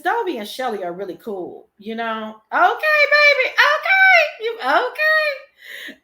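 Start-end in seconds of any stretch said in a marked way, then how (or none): 2.5-6.0 very high, loud squeal, laughing; squealed hype. Pretend. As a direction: shriek it playfully with drawn-out okays. Sudden squealed hype aside.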